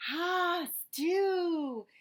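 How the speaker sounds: delighted. Acted. Officer delighted.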